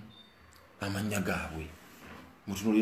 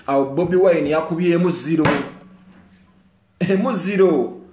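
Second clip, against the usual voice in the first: much higher, louder passionate address; fired-up rally speech; 5 semitones higher, 7 dB louder than usual. Real, not acted. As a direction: rouse the crowd. Fired-up speech about musicians.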